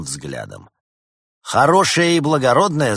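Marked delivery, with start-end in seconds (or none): 1.4-2.9 loud, high sneering echo; mocking repetition. A narrator's reading. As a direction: throw the words back scornfully. Doctor mocks the repeated words.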